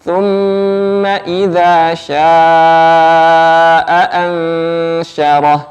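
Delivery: slow, chanted Quranic recitation. Shown as a demonstration. Teacher models the verse for students, slow chant. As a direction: recite it slowly, each letter placed.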